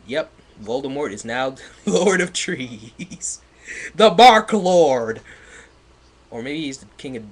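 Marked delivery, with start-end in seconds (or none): 3.9-5.2 loud, slow dramatic boom; booming proclamation. Acted. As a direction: announce it like a villain's title. Mocking loud repeat of typo.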